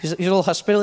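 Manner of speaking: usual speaking voice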